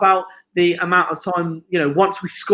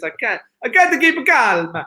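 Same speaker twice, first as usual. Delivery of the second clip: higher, slow-starting mock Italian accent; bad Italian accent; 6 semitones higher, 3 dB louder than usual. Host imitates a friend's T-shirt catchphrase, bad Italian accent. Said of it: "That's the worst Italian accent".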